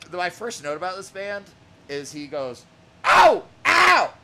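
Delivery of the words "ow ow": sudden high yelps like a stubbed toe; mock pained yelp. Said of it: "Like, like he stubbed his fucking toe".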